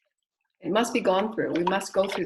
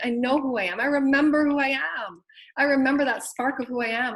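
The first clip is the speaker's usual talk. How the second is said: higher-pitched, swelling affirmation; awed realization; 5 semitones higher, 4 dB louder than usual. Acted. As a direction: voice someone rediscovering who they are, lifting the pitch. Voices an awakened person's joyful realization.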